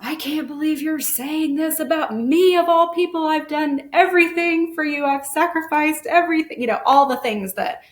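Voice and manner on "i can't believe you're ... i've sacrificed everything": higher and louder, wounded and indignant; mock-offended higher outcry from a hurt parent; hurt defensive outburst. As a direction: snap back like an offended mother. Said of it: "my natural inclination to be like".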